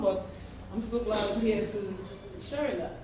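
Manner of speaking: ordinary talk, nothing marked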